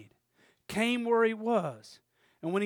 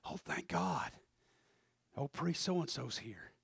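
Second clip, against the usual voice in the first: relieved wounded man; 9 semitones lower, 7 dB quieter, slower than usual. Acted. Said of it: "and he's like".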